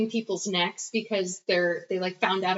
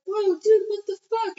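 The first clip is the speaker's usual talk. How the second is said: imitating alarm, pitched way up; high startled protest, played for laughs; mock shock; 12 semitones higher, 3 dB louder than usual. Friend watching his pal kill his girl, mock shock. Retold shocked reaction to a killing.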